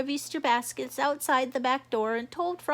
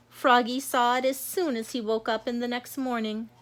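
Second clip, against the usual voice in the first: plain reading voice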